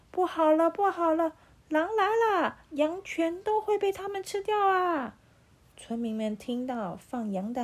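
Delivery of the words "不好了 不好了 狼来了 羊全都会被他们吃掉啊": loud, high alarm call; shouting to the village. A narrator's reading. Shepherd boy calling the village, loud fake alarm. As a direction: yell it out so the whole village hears. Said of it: "他就朝着村庄里面大喊".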